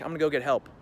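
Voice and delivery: ordinary delivery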